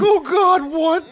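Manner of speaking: high, loud startled dad; a drunk dad jolted in alarm. Retold or imitated. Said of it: "He's like".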